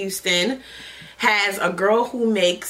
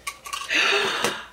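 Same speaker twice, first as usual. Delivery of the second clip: surprised gasp; 11 semitones higher, 4 dB quieter than usual. Real, not acted. Unboxing surprise lighter.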